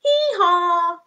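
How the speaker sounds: high loud hee-haw; donkey sound; donkey bray. Pretend. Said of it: "What does the donkey say".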